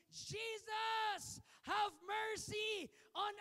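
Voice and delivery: lepers crying out, voice high and loud, stretched slow; desperate shout. Acted. Ten lepers shouting to Jesus for mercy, loud and high. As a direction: fling the plea up high and loud, like a desperate cry. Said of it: "start crying and shouting".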